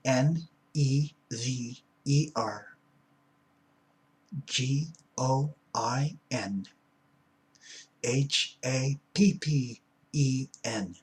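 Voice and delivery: slow, letter by letter; spelling it out. Shown as a demonstration. Speaker spells it out for a slow listener, deliberately. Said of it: "do I need to spell it out".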